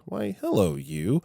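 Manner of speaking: put-on high, sweet coo to a baby; sweet mama voice. Mom greeting her smiling baby boy, sweet mama voice. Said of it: "in that sweet mama voice".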